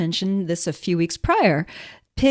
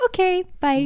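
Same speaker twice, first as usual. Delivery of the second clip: imitating caller; 11 semitones higher than usual. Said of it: "The best part was".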